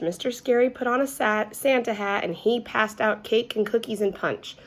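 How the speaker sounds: plain reading voice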